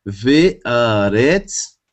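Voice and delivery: Ve aretz, sounded out slowly, rising on Ve; slow Hebrew reading. Shown as a demonstration. Teacher reading Hebrew to the class, slow and careful. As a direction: read the Hebrew Ve aretz syllable by syllable. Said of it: "Segundo verso começaria dizendo".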